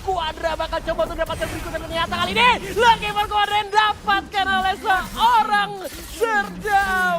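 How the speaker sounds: hyped esports caster calling the kill; fighting game announcer. Acted. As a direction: build it into a roaring play-by-play call. Hype play-by-play of a team fight.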